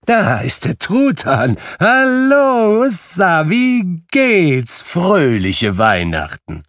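high, loud, drawn-out hallooing; jubilant greeting. Acted. Scrooge hailing the turkey's arrival, jubilantly.

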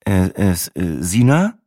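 plain reading voice

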